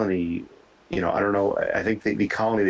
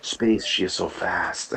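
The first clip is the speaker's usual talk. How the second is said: put-on slow, hammy French accent; cartoon French accent; mock French accent; slower than usual. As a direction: draw it out like a Pink Panther Frenchman. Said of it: "in a French accent to see how it would sound".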